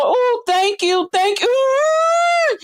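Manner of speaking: high, slow, drawn-out sugary thank you; mocking thank-you. Mocking her friend's sugary high thanks to donors. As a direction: lay it on thick as a mock grateful crier. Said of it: "she was going".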